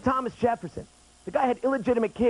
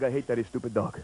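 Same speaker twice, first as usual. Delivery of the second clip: father; 8 semitones lower, 4 dB quieter than usual. Retold or imitated. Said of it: "He's like".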